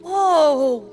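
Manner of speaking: usual speaking voice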